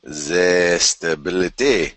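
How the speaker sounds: put-on slow French-accented echo of the word; lightly hammed French accent; mock French accent. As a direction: drag out stability in a French accent. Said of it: "that was a horrible accent".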